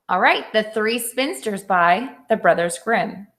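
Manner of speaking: normal talking voice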